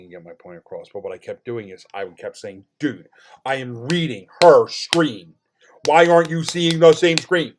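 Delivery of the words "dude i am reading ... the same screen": loud, rising exasperation; exasperated retort. Retold or imitated. Customer to phone company about the screen, loudly exasperated. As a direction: argue it louder and higher as it goes. Retelling exasperated argument in store.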